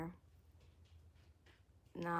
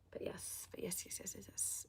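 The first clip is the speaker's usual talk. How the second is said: whispering; 15 dB quieter than usual. Real, not acted. Repeats yes under her breath.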